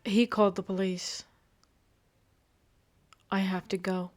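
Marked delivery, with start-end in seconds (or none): none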